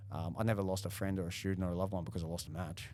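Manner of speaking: normal talking voice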